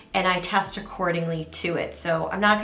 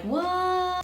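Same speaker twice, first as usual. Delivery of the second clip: a much higher, baffled mock "What?"; confused what; 12 semitones higher than usual. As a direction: play a lost viewer's bewildered squeak. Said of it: "If you're like".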